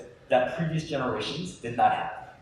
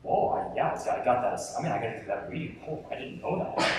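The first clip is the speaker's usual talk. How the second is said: panicked mutter; 5 semitones lower, 8 dB quieter than usual. Retold or imitated. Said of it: "you're like".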